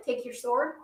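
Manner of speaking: ordinary talk, nothing marked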